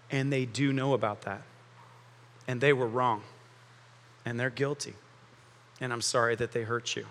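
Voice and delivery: quiet, sincere voice of God; gentle God voice. Acted. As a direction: speak as a kind father comforting a hurt child. Preacher voicing God's gentle reply.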